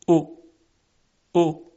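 او said as a rounded Arabic u; Arabic short u. Shown as a demonstration. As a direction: round the vowel as in Arabic reading. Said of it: "همین صدا در زبان عربی". (ضمهٔ عربی)

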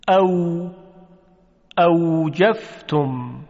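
اوجفتم and یومی said slowly with rounded lips, w sound; Arabic waw drill. Shown as a demonstration. Teacher leading Arabic waw practice, slow and rounded.

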